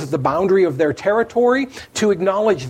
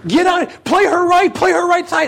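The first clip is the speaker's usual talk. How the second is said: much higher, louder courtside yell; coach yelling; 11 semitones higher, 3 dB louder than usual. Retold or imitated. Coach to her player Michelle during a game, loud yell. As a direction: shout the instruction across the gym. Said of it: "I'm yelling at Michelle".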